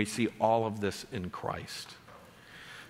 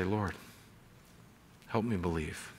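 humble prayer; 6 semitones lower, 8 dB quieter than usual. Retold or imitated. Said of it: "I would say".

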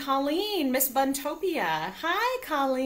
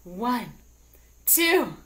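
building count; 4 semitones higher, 5 dB louder, much slower than usual. Acted. Counting down to group shout.

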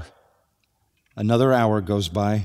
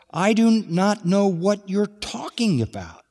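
higher, defensive denial as Peter; a touch of a flustered Peter; Peter denying; 8 semitones higher than usual. Acted. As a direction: brush off the accuser, pitched up.

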